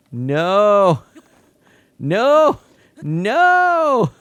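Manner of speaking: escalating dismayed shouts; rising no shouts. Real, not acted. Rain starts in game.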